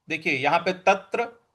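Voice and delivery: normal talking voice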